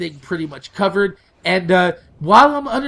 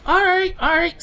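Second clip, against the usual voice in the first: high-pitched compliant reply; lightly mocked girlfriend voice, pitched way up; imitated girlfriend; 12 semitones higher than usual. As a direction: mimic her agreeing in a much higher voice.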